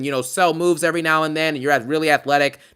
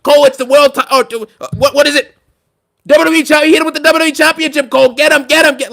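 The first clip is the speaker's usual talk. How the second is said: much higher, louder, flustered play-by-play; frantic commentator impression; 10 semitones higher, 11 dB louder than usual. Streamer does the commentator impression fans requested, frantic and loud. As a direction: babble like a lost announcer botching the belt. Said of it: "Michael Cole and Wade Barrett impression".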